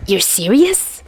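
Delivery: ordinary delivery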